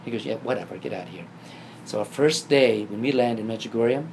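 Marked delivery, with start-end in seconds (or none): 0.2-1.2 quiet, dismissive mock sarcasm, dropping lower; imitating a brother's low-key sarcasm; sarcastic brush-off. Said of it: "It's very sarcastic".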